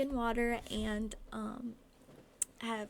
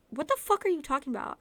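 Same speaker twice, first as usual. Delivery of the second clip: fast, loud, furious; angry shout; 5 semitones higher, 8 dB louder, faster than usual. Acted. Angry quarrel.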